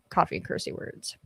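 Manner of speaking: whispered, savoring the words; playful whisper of a viewer's phrase; whispered repeat. Acted. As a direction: whisper it like a secret treat.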